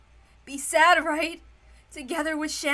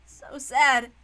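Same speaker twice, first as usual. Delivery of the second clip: ordinary talk, nothing marked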